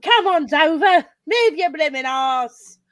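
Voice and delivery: loud Cockney shout at the races; Cockney accent. Retold or imitated. Quoting Eliza at the races in a Cockney accent. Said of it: "Cockney accent".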